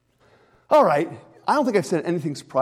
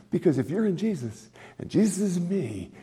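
ordinary talk

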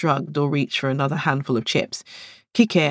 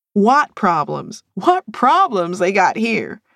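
protesting; 5 semitones higher, 4 dB louder, slower than usual. A narrator's reading. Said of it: "Latoya protested".

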